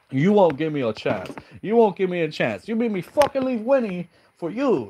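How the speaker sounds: high-pitched, hammed-up whining; whiny little bitch. Lauren blames Debbie for leaving Winnie, mock whining. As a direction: plead and blame in a mock crybaby voice. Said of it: "Starts being such a little bitch".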